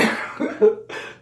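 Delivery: short high breathy laugh; light amused laugh. Real, not acted. Laughing at the coworkers' saying.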